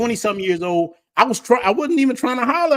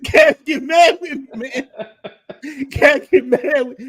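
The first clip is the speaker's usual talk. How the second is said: teasing; 5 semitones higher, slower than usual. Real, not acted.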